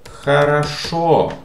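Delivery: хорошо drawn out, loud and high on the last O; slow хорошо, "ha-ra-SHO", last vowel stressed; stress on final O. Shown as a demonstration. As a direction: lean long on the final syllable of хорошо. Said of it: "This letter O is stressed".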